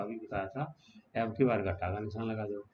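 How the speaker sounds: ordinary talk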